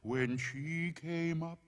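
creepy campfire poem with drawn-out oohs; spooky poem recital. A narrator's reading. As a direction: recite a ghost rhyme to give chills.

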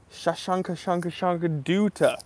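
Shashanka Shanka Shanka Duta rattled off in a high, sing-song run; playful name mangling. Real, not acted. Greeting a viewer by name, playfully garbling it. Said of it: "I mispronounced your name".

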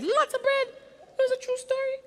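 thrilled storyteller marveling; excited exclaim. A narrator's reading. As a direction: burst out with wonder. Climax of bread falling.

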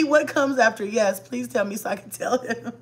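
ordinary delivery

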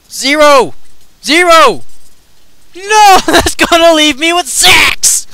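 loud high outcry; dismayed shout. Real, not acted. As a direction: yell in dismay. Dismay at game roll.

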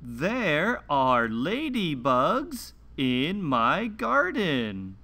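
slow, clear picture-book read-aloud with long pauses; reading to children. Acted. Host reading a garden picture book to young children, slow and clear. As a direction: read each page slowly for little listeners.

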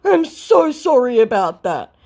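hammed-up sorry; mock scammer voice. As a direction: scammer apologizing, mocked. Voicing scammer apology.

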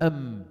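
أم hummed to a close on the lips; letter exit demo. Shown as a demonstration. Teacher testing the meem exit, sounding أم. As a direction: end أم with lips shut. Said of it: "وين انتهى الصوت".

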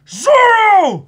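high, loud shout of the name; excited shout. Real, not acted. Watching with a friend, hyped high shout for Zoro. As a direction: cheer as he jumps in.

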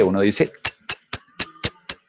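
clipped voiceless final t, not the letter name; sharp t sound. Shown as a demonstration. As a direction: spit out a short unvoiced t, like in "worked". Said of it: "pero uno no dice T".

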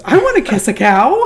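a hint of the shy girl lighting up; eager young woman. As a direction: answer with sudden eager delight. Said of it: "And this girl goes".

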